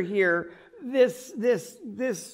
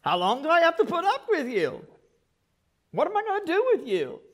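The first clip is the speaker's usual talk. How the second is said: exasperated; 8 semitones higher, faster than usual. Retold or imitated. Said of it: "they just got put in their place".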